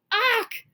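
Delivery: high, loud squawk; startled yelp. Acted. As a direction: yelp as you're grabbed. Giant grabs Jack.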